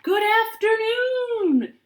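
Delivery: high, loud, slow cicada screech. Acted. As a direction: screech the greeting like a cicada on a branch. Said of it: "screeched a cicada".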